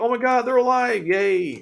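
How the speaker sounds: high-pitched, put-on relieved cheer; mock cheering. Allies seeing their friends survived, mock cheering. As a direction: imitate friends squealing with relief.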